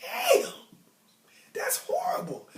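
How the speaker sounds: high, breathy squeal of disbelief; shocked disbelief. Retold or imitated. Telling a classmate he scored badly, squealing in shock. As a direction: gasp out the score like it's unbelievable. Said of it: "I was like".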